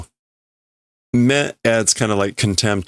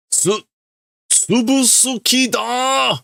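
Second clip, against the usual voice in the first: high, slow, shouted threat; a hammed-up baddie roaring a threat; villain shout; slower than usual. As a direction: yell it out like a cartoon villain.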